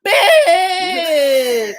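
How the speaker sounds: high, loud shrieking bitch with a laugh; mock shriek. Podcast host mocking a critic, shrieking with a laugh. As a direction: shriek it out, cackling in mock outrage.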